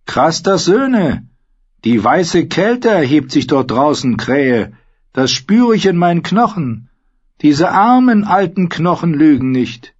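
high-pitched, loud crone's warning; foreboding old woman. Acted.

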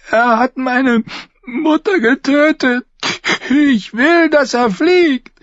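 put-on the frail boy lord, pitched far up; shrill sickly boy. A narrator's reading. Robert demanding the singer be thrown out, high child voice.

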